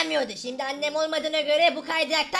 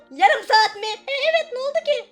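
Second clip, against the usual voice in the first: normal talking voice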